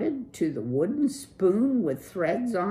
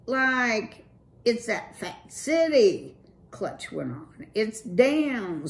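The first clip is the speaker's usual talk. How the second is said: higher, loose slacker-dude voice; Clutch, cool dude; 8 semitones higher, 6 dB louder than usual. Acted. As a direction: play the laid-back streetwise rocker.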